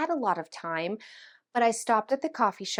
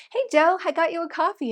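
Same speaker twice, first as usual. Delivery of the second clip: high, quick, breezy greeting; totally fine; 7 semitones higher, 4 dB louder, faster than usual. Retold or imitated. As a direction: greet him as if nothing happened. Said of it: "who else is totally fine".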